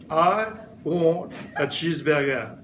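mock French accent on the order; playful put-on French accent. Shown as a demonstration. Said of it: "you have to say it with a French accent".

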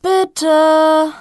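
high, loud drawn-out please; child's eager please. Acted. Jessie shouts please to her sister, high child voice. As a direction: sing out the magic word like a kid.

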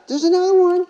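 put-on a boy squealing with delight at the window; really excited kid. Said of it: "He's really excited".

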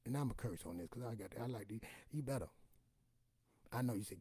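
ordinary talk

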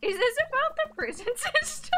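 laughing through the words; high, loud laughing question. Real, not acted. Laughing at absurd plot.

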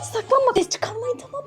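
hushed whisper, hiding; whispering while hiding. Real, not acted. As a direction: whisper so nobody hears. Hiding from grandma, hush.

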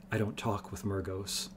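said shortly. Acted. Hedar brushing off Wolf's question, curt and quiet. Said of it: "Hedar said shortly".

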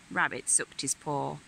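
ordinary delivery, nothing marked

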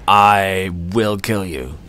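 slow, dark vow of revenge; slow murderous vow; menacing threat. Acted. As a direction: promise it slowly, as the avenger. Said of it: "my character is too pumped up".

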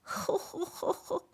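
slow breathy weeping; ghost's sobbing. A narrator's reading. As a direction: sob softly into the fist. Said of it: "拳で目を拭いた".

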